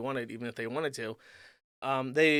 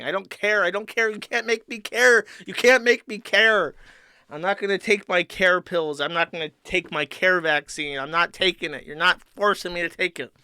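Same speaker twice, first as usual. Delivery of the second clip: mock tantrum; 4 semitones higher, 6 dB louder than usual. Streamer mocking anti-vaxxers as a whiny refusing child. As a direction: play a defiant kid stamping his foot.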